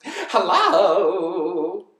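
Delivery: high, long sing-out call. Acted. Bird hails passing elephant, loud high call. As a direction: call out loudly in a drawn-out bird voice. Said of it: "called out the lazy bird".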